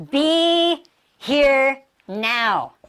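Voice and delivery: BE, HERE, NOW each stretched long and separated; very slow, level, word by word; drawn-out words. Shown as a demonstration. Teacher dwells on each word.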